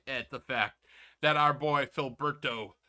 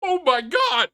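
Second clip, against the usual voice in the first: mock shock; 11 semitones higher, 10 dB louder than usual.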